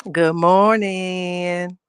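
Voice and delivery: very drawn-out sing-out greeting; like that lady. Acted. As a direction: stretch the greeting right out, imitating that lady. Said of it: "I sound like that lady".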